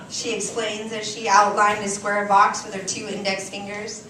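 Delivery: ordinary talk, nothing marked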